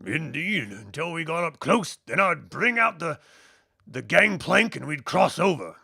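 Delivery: lilting put-on voice, weighing options; deliberating shipmate. Shipmate puts two routes to the crew, deliberate. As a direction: propose the plan in character, with swinging pitch.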